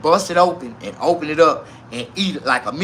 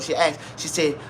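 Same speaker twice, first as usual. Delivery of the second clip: normal talking voice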